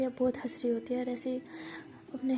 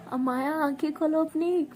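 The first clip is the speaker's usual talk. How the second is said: higher and louder, commanding; wake-up call; 4 semitones higher, 7 dB louder than usual. Acted. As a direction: snap her awake. Waking her loudly. (ऊँची आवाज़ में जगाना)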